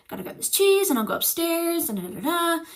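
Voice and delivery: high, soft humming chatter to distract herself; mock carefree mumbling; distracting myself. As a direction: hum along casually, pretending nothing's wrong. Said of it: "like that, trying to, like, distract myself".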